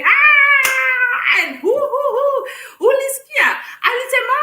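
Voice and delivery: high, loud excited gossip in a crowded train; loud Kenyan chatter. Retold or imitated. Imitating Kenyans gossiping loudly on a train, high and loud. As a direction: shriek out the gossip like noisy passengers. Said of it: "they will be like".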